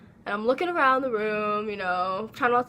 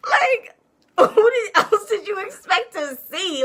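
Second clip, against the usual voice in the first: laughing; 9 semitones higher, 7 dB louder, slower than usual. Real, not acted. Laughing while recounting confusion.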